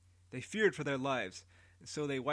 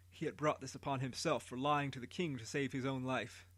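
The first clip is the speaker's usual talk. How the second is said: ordinary delivery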